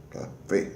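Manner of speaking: "Café" softly split as ca, fé; syllable-by-syllable demo. Shown as a demonstration. Teacher giving an example of final stress, "Café" softly broken into syllables. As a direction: break "Café" in two with the last syllable clear. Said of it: "a tônica na última sílaba".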